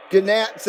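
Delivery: "gnats" said guh-nats with the G sounded; hard G gnats. Shown as a demonstration. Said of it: "I pronounced the G in gnarly and gnats".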